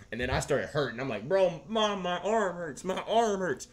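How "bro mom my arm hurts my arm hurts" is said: put-on whiny kid complaining. Retelling complaining to mom.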